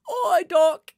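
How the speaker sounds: high, loud cheery greeting in a put-on voice; Ollie impression. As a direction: chirp a bright hello like a friend dropping in. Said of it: "I can't do the voice".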